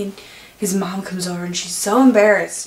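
normal talking voice

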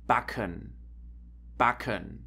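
quick, hard K in backen. Shown as a demonstration. German teacher showing ck to learners, hard quick K in backen. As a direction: hit the ck of backen crisply. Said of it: "a bit harder and faster".

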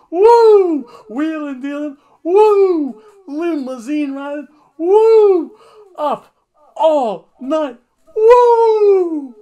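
very high, loud woos with laughing; Ric Flair promo. Retold or imitated. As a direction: shout the Ric Flair brag, woo between every phrase. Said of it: "Ric Flair's like".

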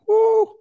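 sharp, high "woo"; impressed preacher's high "woo"; high whoop. Real, not acted. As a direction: let out a loud, high whoop. Preacher reacts to the verse.

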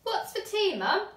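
put-on a girl asking mum for tea; hungry child. A narrator's reading. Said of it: "asked Laura".

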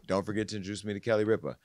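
fast, tossed-off reminder; quick request. Retold or imitated. Host quoting Wendy's only request, said fast. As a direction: rattle it off quickly. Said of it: "And I would say".

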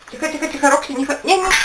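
high urgent hushing; urgent calling. Real, not acted. Dog bolts in fright. (встревоженно)

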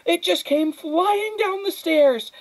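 high, loud, drawn-out outcry; shrieky and aghast; shouting. A narrator's reading. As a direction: shout it in alarm, stretching the words. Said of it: "shouted Flora's mother".

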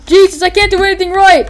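loud, high outburst of frustration; mock exasperated yell. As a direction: play a hapless fighter losing it. Voicing a failing shooter.

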